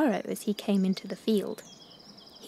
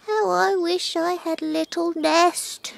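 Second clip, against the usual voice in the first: tearful; 8 semitones higher than usual. Acted. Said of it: "she told Scarecrow tearfully".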